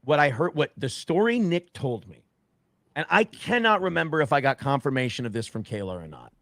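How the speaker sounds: ordinary talk, nothing marked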